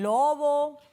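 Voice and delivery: "lobo" stretched slowly, syllables joined; drawn-out word. Shown as a demonstration. Teacher joining syllables for children, "lobo" drawn out. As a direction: lengthen each syllable of "lobo". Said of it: "miren cómo se dice".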